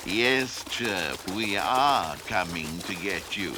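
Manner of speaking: low, soft echo of the promise; understated cat voice, quietly scheming; sly kitten. A narrator's reading. Tom slyly echoes Peep's call.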